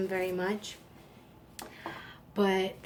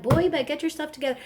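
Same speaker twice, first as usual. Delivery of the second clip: put-on quick, higher, playful southern accent; 6 semitones higher, 5 dB louder, much faster than usual. Mom playing with son Noah to defuse tension, southern accent. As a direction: sass him in a fast southern accent. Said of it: "like, do a southern accent".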